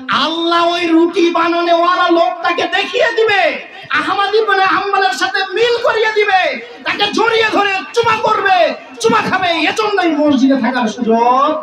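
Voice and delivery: high, ringing sermon cry; sustained high-pitched preacher's proclamation; full-force preaching. Real, not acted. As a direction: drive the point home to the congregation.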